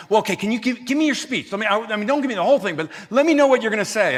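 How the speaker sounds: loud, high, pressing request; insistent husband. Retold or imitated. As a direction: imitate a husband eagerly demanding a preview. Husband voiced, pressing for the speech.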